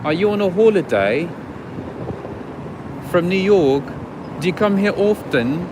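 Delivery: lightly mimicked English accent; London accent. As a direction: chat up a tourist in a put-on London accent. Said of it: "London, they're like".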